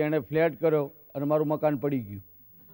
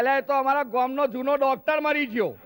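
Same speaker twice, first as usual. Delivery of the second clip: village uncle punchline; 6 semitones higher than usual. Acted. Uncle telling the doctor the joke's ending.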